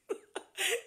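high bright burst of laughter; short laugh. Real, not acted. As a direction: let out a quick laugh. Laughs between two takes.

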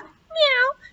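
very high squeaky meow like a cat. A narrator's reading. Mouse calls her friend cat with a high meow. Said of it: "She called her friend Cat".

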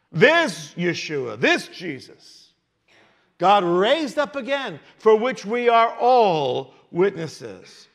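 slow, weighty proclamation; emphatic preaching. Acted. Preacher as Peter declaring the resurrection to witnesses, emphatic preaching. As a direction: proclaim it slowly, leaning on each key word.

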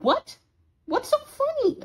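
high-pitched, affronted question; baffled. Acted. Roommate asking why the other laughs at the nickname, baffled. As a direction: pitch leaping up in bewilderment.

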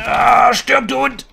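loud, high battle yell; furious shout. Real, not acted. Gamer screaming at an enemy player mid-fight, furious.

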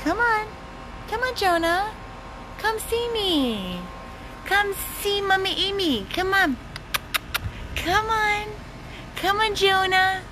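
high-pitched doggy talk. Real, not acted. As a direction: call him in a high pet voice. Coaxing blind dog in baby talk.

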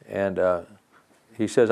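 usual speaking voice

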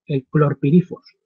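normal talking voice

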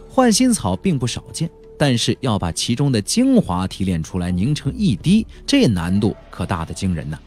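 usual speaking voice

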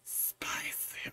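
breathy teasing whisper. Pretend. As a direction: whisper it like a secret. Echoing the spicy request, teasing.

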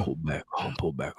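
low, smooth, silky voice; smooth buttery voice. Pretend. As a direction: lay on a buttery radio voice. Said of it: "that smooth, buttery voice".

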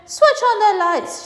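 high, loud, drawn-out order; shouted order down the tunnel; commanding shout. A narrator's reading. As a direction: call it out long and high. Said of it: "shouted Mister Wonka".

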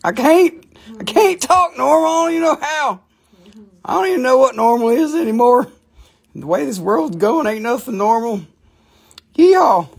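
high-pitched, hammed-up yokel; mock yokel voice. Seller jokes he can't talk normal, mock yokel voice.

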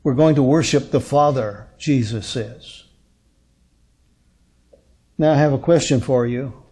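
usual speaking voice

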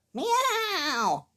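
high mewing cat cry; playful imitated meow. A narrator's reading. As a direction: mew like a little cat.

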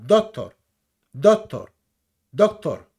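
DOCTOR with force on the final -OR; powerful last vowel. Shown as a demonstration. Teaching viewers English stress, punched final vowel in "doctor". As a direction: punch the second syllable of each "doctor". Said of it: "Hay que darle potencia a la última vocal".

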